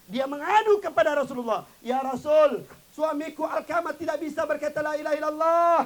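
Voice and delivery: loud, high urgent appeal; desperate plea. Acted. Wife begging the Prophet about her dying husband, urgent. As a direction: beg the Prophet for help.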